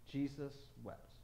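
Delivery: low, soft; hushed reverence. Acted. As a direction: let it fall softly, almost mournful. Reading the shortest Bible verse.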